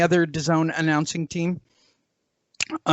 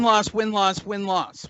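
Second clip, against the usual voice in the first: normal talking voice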